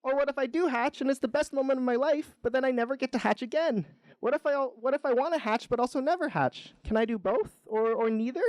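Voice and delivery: high-pitched fretful rambling; worried egg. A narrator's reading. Egg frets over every hatching outcome, high and panicky. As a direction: spiral into worry, pitched right up.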